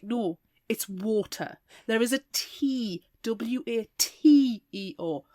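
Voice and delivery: slow, spelled-out precise water; over-enunciated. Shown as a demonstration. Snob correcting schoolmates, slowly enunciating. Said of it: "taught me to enunciate".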